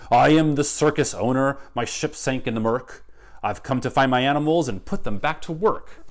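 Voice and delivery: booming proclamation of the boss; loud and clear. Acted. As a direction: announce it to the whole town. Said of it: "whose voice was loud and clear".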